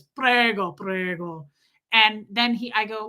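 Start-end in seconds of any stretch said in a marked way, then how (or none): 0.0-1.5 lightly imitated Italian cook, second word dropping away; a hint of showy Italian; Luigi. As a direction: toss it back like an Italian host. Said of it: "And he goes".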